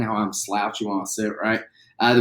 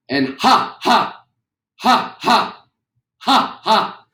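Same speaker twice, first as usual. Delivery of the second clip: sharp high loud ha bursts from the belly; diaphragm ha pulses; 11 semitones higher, 5 dB louder, much slower than usual. Shown as a demonstration. Teacher demonstrating diaphragm work, loud high ha bursts. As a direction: punch each ha from the diaphragm. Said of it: "Big breath in".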